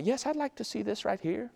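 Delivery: high, soft polite request; imitated Chinese customer. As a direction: put on a small, high customer's voice. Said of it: "Chinese walk up there and they'll be like".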